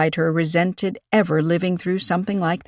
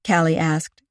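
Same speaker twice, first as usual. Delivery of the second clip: plain reading voice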